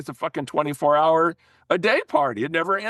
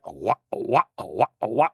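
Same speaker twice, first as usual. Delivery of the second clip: vocal guitar chug; 5 semitones lower than usual. Retold or imitated. Imitating rock guitar chugging.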